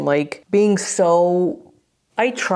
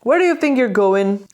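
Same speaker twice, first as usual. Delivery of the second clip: higher, mildly challenging mock steward; imitating rude steward; 4 semitones higher, 3 dB louder than usual. Rude steward stopping a lost fan, imitated with mild challenge. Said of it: "He was like this".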